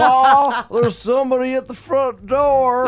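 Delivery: high calling voice. Pretend. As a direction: call out the warning in a high voice. Acted warning call.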